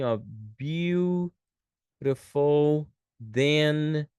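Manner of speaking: very slow, drawn-out example sentence; slow dictation. Shown as a demonstration. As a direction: dictate word by word with long pauses. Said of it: "A gente vai falar assim".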